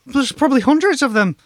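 high, loud flustered voice; panicked Tedby voice. Acted. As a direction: babble in a nervous panic.

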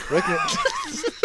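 high, loud laugh breaking through. Real, not acted. Telling friends the wreck story, laughing hard.

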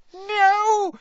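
very high, loud squealed cry; shriek. A narrator's reading. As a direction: shriek it in horror as a tiny elf. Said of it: "shrieked Winky".

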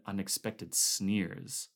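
usual speaking voice

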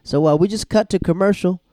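big loud call to break; loud announcer cut to break; mock announcement. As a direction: boom it out like a TV host. Host calls out a cut.

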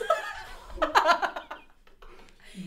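big high burst of laughter; loud, high cackling laugh. Real, not acted. Storyteller cracking up at the other kid's rude answer, laughing. Reacting to retold rude remark.

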